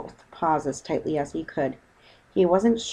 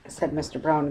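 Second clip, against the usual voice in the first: usual speaking voice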